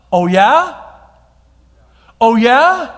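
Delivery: loud, challenging question; defiant retort. Acted. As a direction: throw the challenge back at a doubter.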